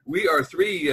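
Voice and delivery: ordinary delivery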